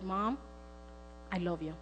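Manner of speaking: soft, low, with a pause; tender. Retold or imitated. Daughter answers her mother's apology, tender. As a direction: say it quietly and sincerely.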